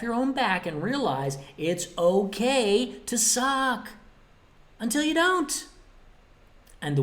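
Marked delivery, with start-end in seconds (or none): 1.6-5.7 slow, higher, stretched "it's okay to suck"; drawn-out catchphrase. Real, not acted. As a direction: stretch out "suck", lift "until you don't".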